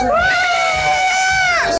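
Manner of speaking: very high shouted yeah; wild yell. Real, not acted. Fan screaming in celebration.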